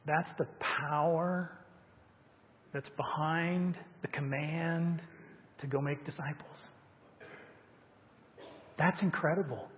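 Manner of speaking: ordinary talk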